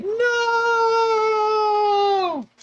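long, very high, loud scream; drawn-out mock scream of anguish; despairing wail. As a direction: drag it out in hammed-up despair. Character's despairing reaction acted out.

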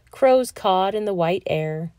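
ordinary delivery

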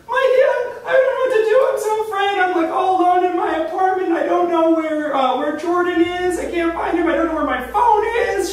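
high-pitched imitation of a tearful, drunk friend; mock crying. Wasted friend phoning for help at two A M, tearful high mock wail. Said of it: "And she's crying. She's like".

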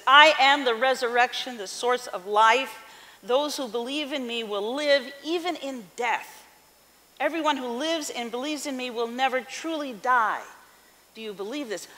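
preaching. Acted. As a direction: preach it out to the church. Preaches Jesus' words.

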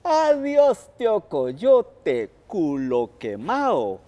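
loud, slow mocking farewell; Tío Conejo jeers at the burnt coyote from the bushes, a slow loud shout; taunting shout. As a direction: jeer from behind the bush. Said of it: "le gritó".

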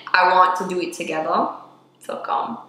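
usual speaking voice

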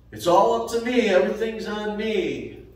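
whiny overwhelmed parody of a worrier; mock fretting. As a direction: ham up the frantic self-reliance. Mocking self-reliant worrier.

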